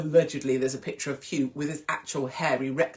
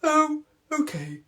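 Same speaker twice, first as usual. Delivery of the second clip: mock shrug; 10 semitones higher, 3 dB louder, slower than usual. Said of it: "we all went".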